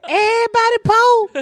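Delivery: high cry of betrayed surprise; shocked outburst. Retold or imitated. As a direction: squeal it in dismay. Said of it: "I said".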